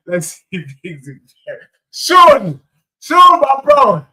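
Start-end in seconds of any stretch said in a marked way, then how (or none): 1.9-4.1 higher, louder, slow Sean, my bro; calling out a greeting. Real, not acted. Streamer greeting viewer Sean in chat, loud call. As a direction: hail him from across the room.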